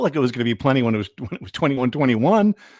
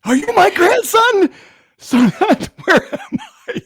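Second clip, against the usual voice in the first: put-on much higher, louder creaky-old-man voice; 7 dB louder, slower than usual. Old fan at a press conference asks his grandson, cartoon old man voice. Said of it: "in that voice".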